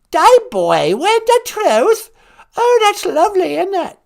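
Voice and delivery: high, delighted British granny; hammed-up royal delight; put-on old monarch savouring a new word; Queen Mum. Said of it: "It's the Queen Mum".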